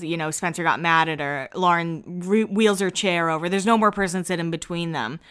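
ordinary talk

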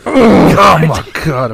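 mock agonized cry, high and loud then dropping; pained outburst. Storyteller acting out his fall on the porch, hammed-up pained outburst. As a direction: burst out in acted pain, then rein it in. Acted reaction to bleeding shin, hiding pain.

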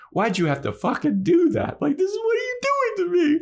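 high, fast, whining complaint; mock desperate whining; whiny Spike. Spike blaming Drusilla, voiced.

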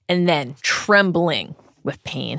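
ordinary delivery

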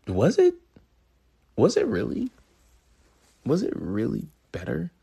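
high, doubtful self-questioning; skeptical questioning. Acted. Host asking himself if old music was better, skeptical. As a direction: pitch it up like you doubt it. Questioning his own nostalgia.

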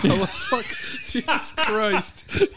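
laughing shout. Real, not acted. Laughing off crying fit.

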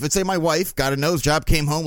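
normal talking voice

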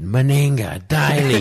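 high, drawn-out mock commentary; backyard commentator. Kid commentating in the backyard, excited play-by-play. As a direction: call the names like an excited commentator. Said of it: "in the backyard in Tinga commentating".

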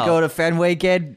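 high Boston guy voice; Boston accent. Pretend. Joins Boston bit.

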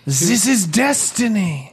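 solemn, theatrical French bomb; French accent. Acted. Bomb declaring its destiny, theatrical French accent. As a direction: declare it grandly in mock French. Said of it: "It's got eyes and a French accent".

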